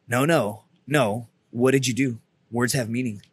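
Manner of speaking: low, measured gunny imitation; stern correction.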